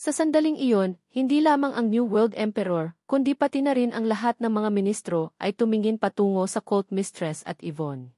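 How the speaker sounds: usual speaking voice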